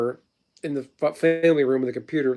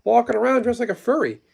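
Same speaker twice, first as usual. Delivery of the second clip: much higher, indignant retold outrage; horrified; 9 semitones higher than usual. Dad near the playground reacting to a passing furry, higher and appalled. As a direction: squeak it out like a shocked parent at the park.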